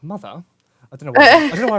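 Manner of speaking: posh English "Mother"; plummy British accent. Retold or imitated. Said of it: "I really posh that".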